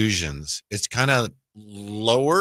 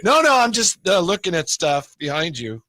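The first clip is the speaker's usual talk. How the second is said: sheepish customer; 8 semitones higher than usual. Retold or imitated. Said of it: "sly customer reply".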